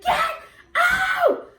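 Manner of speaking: very high, loud shout with a laugh; shouting together. Retold or imitated. Both friends shouting at the ghost, high laughing shout. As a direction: scream it out together. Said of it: "Kristen started joining in".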